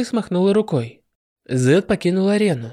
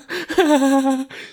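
evil cackle; 9 semitones higher than usual. Acted. Villain after declaring war.

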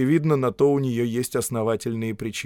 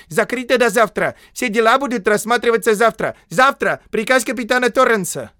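very high, loud frantic cry; sudden shout; 12 semitones higher, 9 dB louder than usual. A narrator's reading. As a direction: shriek the office shut. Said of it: "закричал вдруг писарь". (внезапный высокий крик писаря)

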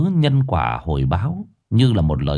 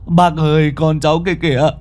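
frantic; 8 semitones higher than usual. Acted. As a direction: insist wildly that the child is right there. Frantic man sees his child. (hốt hoảng gọi bác, giọng cao)

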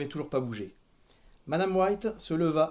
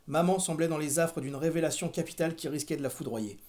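ordinary delivery, nothing marked

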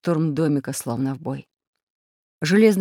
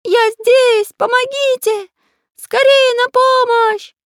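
very high, loud cartoon cries; parrot mimicking Карандаш; 7 dB louder, slower than usual. A narrator's reading. Parrot luring friends with pencil's voice, shrieking. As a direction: shriek for help in a squeaky voice. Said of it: "Продолжал кричать попугай Кутя-Плютя голосом карандаша".

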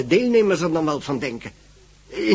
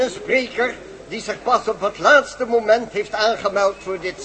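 raised, formal chairman's introduction; formal announcement; 5 semitones higher than usual. Acted. Chairman announcing the professor to the symposium, formally. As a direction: announce the speaker to the room.

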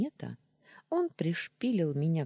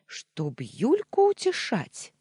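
high, loud, drawn-out accusation; indignant shriek; 9 semitones higher, 7 dB louder, slower than usual. A narrator's reading. Wife suspecting husband over another woman, indignant shriek. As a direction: hurl the question, voice pitched up. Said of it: "взвизгнула Таня".

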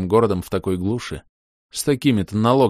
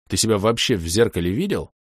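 usual speaking voice

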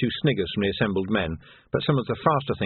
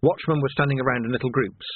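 ordinary delivery

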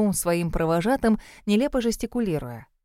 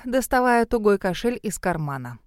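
plain reading voice

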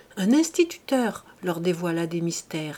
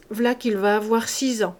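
plain reading voice

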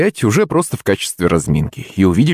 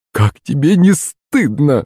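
higher, drawn-out reproach; scolding cry; 5 semitones higher, slower than usual. A narrator's reading. Woman rebukes drunken servant, scolding cry. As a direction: exclaim slowly, shaming him. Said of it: "вскрикнула Лариса Батьковна".

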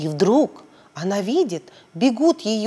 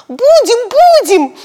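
put-on much higher and louder child cry; girls shouting; 13 semitones higher, 10 dB louder, slower than usual. Girls promising the policeman, excited shout. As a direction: shout eagerly like little girls. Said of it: "закричали Белочка и Тамарочка".

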